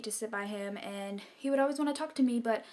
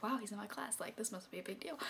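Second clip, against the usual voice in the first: softer, dreamy thought to herself; hushed inner thought; 10 dB quieter than usual. Retold or imitated. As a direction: murmur it like a private realization. Inner thought about crush.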